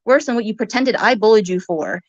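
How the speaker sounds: usual speaking voice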